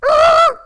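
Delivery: very high, loud frustrated shriek; exasperated scream. Retold or imitated. Driver at the end of his rope, screaming in frustration. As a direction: blow up in a wordless yell. Acts out second, bigger outburst.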